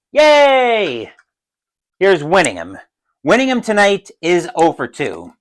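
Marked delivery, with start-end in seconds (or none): 0.1-1.1 high, drawn-out yay; mock crowd cheer. Solo player voices the cheering stadium, mock cheer. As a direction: play the whole stadium cheering in one long high cry.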